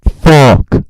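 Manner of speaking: real full-force yelling into the mic; blowing out microphone. Real, not acted. Speaker cursing themselves for blowing out the mic, very loud yell. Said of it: "I blow out the fucking microphone again".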